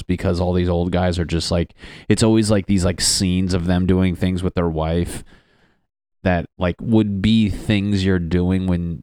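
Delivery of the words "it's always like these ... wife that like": ordinary delivery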